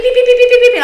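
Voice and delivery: high, loud mimicked beeping; loud, high imitation of an alarm beeping. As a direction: beep like a frantic alarm.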